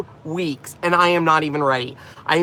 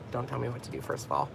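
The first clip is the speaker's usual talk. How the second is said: lower, quieter mock sass, pretend retort; mock sassy comeback; 5 semitones lower, 13 dB quieter than usual. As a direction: hand off, a low pretend snap at the teacher. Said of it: "I'm just kidding, I didn't say that".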